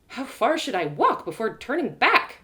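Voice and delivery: ordinary delivery, nothing marked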